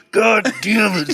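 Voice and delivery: high, drawn-out mock curse; hammed-up slow, high exclamation; mock outburst. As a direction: imitate a wild man cursing high. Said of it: "And he's like".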